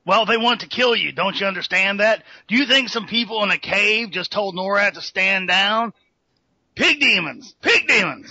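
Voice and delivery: ranting conspiracy host, getting fired up; Alex Jones impression. Prank caller ranting at the podcast host, fired-up radio rant. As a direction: rant like a paranoid radio host, building to a yell. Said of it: "better than fucking Alex Jones himself".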